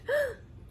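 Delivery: high, quick intake of breath; sharp gasp. A narrator's reading. Maria seeing the ring on her mother's finger, gasping. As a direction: gasp in sudden surprise. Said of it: "Then she gasped".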